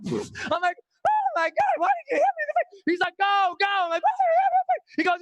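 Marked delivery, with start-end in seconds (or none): 1.1-2.8 very high, rapid squealed complaint; hysterical mock shriek. Punched skydiver to his guide, high hysterical shriek. 3.2-3.7 high, urgent acted order; urgent guide. Guide to falling student, high and urgent. 4.0-4.9 very high, loud squeal; hysterical mock shriek. Punched skydiver to his guide, loud high shriek.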